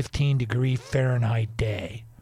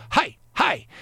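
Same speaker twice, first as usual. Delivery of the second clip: imitating a chirpy waitress; 12 semitones higher, 4 dB louder than usual. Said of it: "she'd go".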